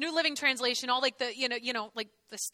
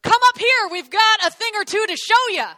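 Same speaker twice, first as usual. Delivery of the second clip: yelled taunt; 7 semitones higher, 8 dB louder than usual. Retold or imitated. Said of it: "they yelled down to Jonathan".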